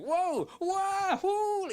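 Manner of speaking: high, loud whooping yelps as if sucked away; flailing whoops. Retold or imitated. Mock panic from a Beatle, whooping high and loud. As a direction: yelp it out like being pulled off your feet. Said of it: "meant to be flailing around the room going".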